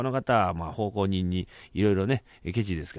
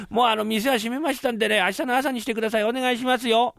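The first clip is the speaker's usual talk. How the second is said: servant calling through door; 11 semitones higher than usual. Acted. Servant calls through door to customer.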